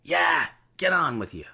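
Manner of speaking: rough coaching man's voice; gruff coachman. Acted. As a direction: bark it like a tired coachman.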